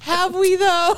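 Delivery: high, loud teasing "Have we, though?"; mock incredulous; playful doubt. Joking doubt, laughing.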